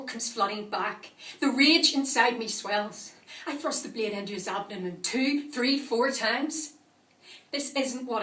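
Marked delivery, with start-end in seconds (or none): 1.4-6.7 swelling rage. Acted. Attacker reliving the stabbing, swelling rage. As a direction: drive each blow home with the voice.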